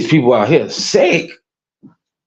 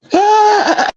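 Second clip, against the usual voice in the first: high cackle. Real, not acted. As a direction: cackle at your own joke. Laughs at own joke.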